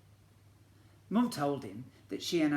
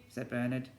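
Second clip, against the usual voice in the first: usual speaking voice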